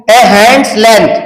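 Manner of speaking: each word stretched out, very slow; slow classroom dictation of the example. Shown as a demonstration. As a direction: spell it out by voice for note-taking. Teacher reads example phrase.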